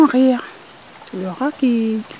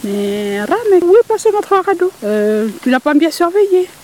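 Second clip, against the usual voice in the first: voix de poule plus haute et plus forte; la poule interpelle le rat, haut et fort; poule sultane qui demande; 8 semitones higher, 4 dB louder than usual. Acted. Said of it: "elle lui demande". (poule qui gronde le rat, plus haute et plus forte)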